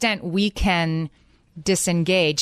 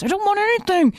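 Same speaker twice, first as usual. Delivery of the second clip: much higher, whiny refusal; sulky teen whine; 13 semitones higher than usual. Retold or imitated. Teenage son whining at his parent, sulky. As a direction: whine it like a put-upon teenager.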